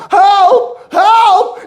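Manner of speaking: high, loud cry of help; mock scream for help. Woman trapped in the burning house, loud mock scream. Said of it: "a scream".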